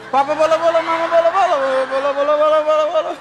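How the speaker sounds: high, loud, fast laughing babble; mock Tinatun tongues. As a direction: rattle off the bolo chant, cracking up. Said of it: "mereka basah lidah persis Tinatun". (meniru bahasa lidah Tinatun sambil tertawa, cepat dan tinggi)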